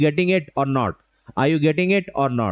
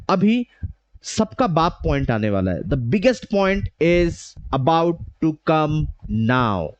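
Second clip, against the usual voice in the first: suspenseful build with pauses, NOW held back; power pauses; 2 semitones lower than usual. Shown as a demonstration. Trainer teasing the biggest point to students, with power pauses. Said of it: "I have taken a pause".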